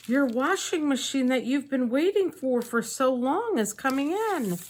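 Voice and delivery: chirpy mock announcement; excited. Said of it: "she was so excited".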